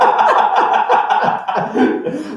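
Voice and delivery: long high breathy laugh; helpless giggling fit. Real, not acted. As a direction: crack up at the request. Laughs at song requests.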